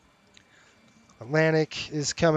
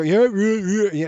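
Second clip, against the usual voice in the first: mock bored teenager, a bit higher and louder; teen brush-off; 5 semitones higher, 7 dB louder than usual. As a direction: toss it off like an impatient fifteen-year-old. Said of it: "You know, she's fifteen".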